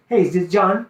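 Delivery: "John" lifted like a question; questioning tonality. Shown as a demonstration. Sales coach demonstrating the cold-call opener, questioning tonality. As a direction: say "Hey, is this John?" with a rise on "John". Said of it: "the tonality is like a question".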